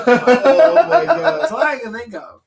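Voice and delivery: slow laughing oh my God. Real, not acted. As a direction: laugh through the words. Laughing at own silly idea.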